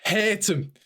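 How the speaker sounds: high, hammed-up ranting to his mum; mock tantrum cry, pitched high; mock drunken rant. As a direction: yell it like a sulky drunk. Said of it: "screaming at her".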